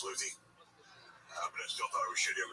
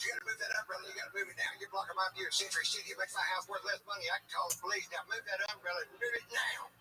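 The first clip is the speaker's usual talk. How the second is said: high, pushy mock neighbor voice; nagging neighbor's wife; 11 semitones higher, faster than usual. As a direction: bark her demands up high and bossy. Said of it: "That's how she talked".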